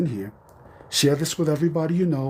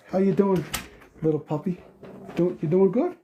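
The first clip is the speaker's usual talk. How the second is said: puppy talk; 6 semitones higher than usual. Real, not acted. Greeting the dog he finds.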